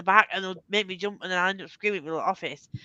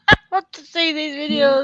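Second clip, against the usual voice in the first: laughing; 8 semitones higher, 12 dB louder than usual. Real, not acted. Still laughing after friend's story.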